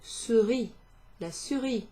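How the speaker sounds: souris said clearly, ending on a plain ee sound with no r; souris modelled. Shown as a demonstration. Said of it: "Not to be confused with".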